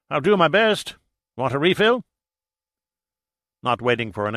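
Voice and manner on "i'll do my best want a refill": put-on high, loud, hearty old skipper; hearty sea captain. A narrator's reading. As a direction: speak up like a seasoned diving captain.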